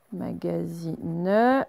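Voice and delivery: slow, drawn-out dictation; dictating syllable by syllable. Shown as a demonstration. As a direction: stretch the word for pupils to write. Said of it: "dictating word to class".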